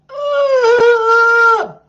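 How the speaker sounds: high, loud drawn-out grunt; mock walrus bellow, high and loud; horrendous bellow. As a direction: let out the ungodly noise again. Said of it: "louder and longer and more horrendous".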